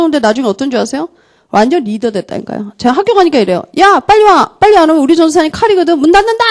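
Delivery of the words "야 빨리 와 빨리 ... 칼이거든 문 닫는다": high, hurried call to classmates; mock urgent warning, high-pitched. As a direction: imitate a student rounding up friends, pitched high. Student calling classmates to hurry.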